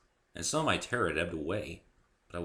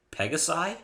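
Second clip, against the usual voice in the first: ordinary delivery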